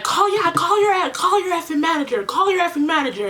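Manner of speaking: high, rapid imitated tirade; retold crazed demand. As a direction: mimic her frantic, repeated demand. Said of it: "going crazy".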